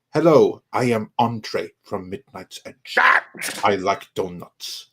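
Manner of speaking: put-on slow, measured Andre accent after a punched hello; Tom doing Andre's accent. Host retrying the Andre line, slow imitated accent. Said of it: "try to sound like Tom doing the accent".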